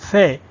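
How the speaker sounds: slow, careful سے with a lisped th; Arabic th sound. Shown as a demonstration. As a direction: breathe سے out as the English "th" in think. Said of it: "تو یہ اس کی اصل آواز ہے".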